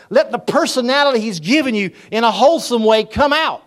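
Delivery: high, loud preacher's exhortation; preaching at full force. Acted. Preacher urging the congregation to be themselves, full preaching voice. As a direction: drive it home to the pews.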